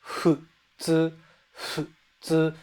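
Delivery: futsu with a soft blend of H and F; Japanese futsu. Shown as a demonstration. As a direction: breathe the F of futsu, halfway to an H. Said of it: "combining H and F".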